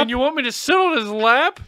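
high, loud, appalled question; incredulous outrage. Retold or imitated. Scared kid told to sit with Santa, loud disbelief. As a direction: protest as if horrified by the idea.

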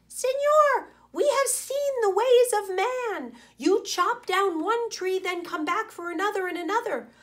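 high, excited monkey chatter. Acted. Monkeys scolding the sleeping man, high chattering voices. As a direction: pitch it way up like a troop of monkeys. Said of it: "They chattered to the sleeping man".

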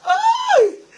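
high, loud cry of fright; startled shriek. Real, not acted. Streamer reliving the scare for viewers, shrieking. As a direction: let out a sharp scream after the scare. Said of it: "me espanté".

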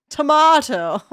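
high, loud British Tomato!, the middle said mah; proud British Tomato!, tomahto; British accent. Acted. Streamer crowning the winner in a British accent. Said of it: "My perfect British accent".

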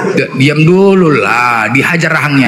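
slow stern voice. Acted. Ananias voiced while slapping.

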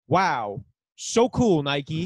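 mock-awed sarcasm with exaggerated stresses; sarcastic. Reading [name]'s tweet about Nike to co-hosts, very sarcastic. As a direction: lay on the fake admiration thick. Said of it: "a very sarcastic tone".

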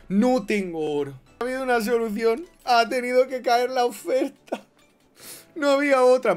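high, loud dramatic exclamation; dramatic outcry. Real, not acted. Streamer justifying an offer for gold, loud outcry. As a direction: belt it out to the viewers, high.

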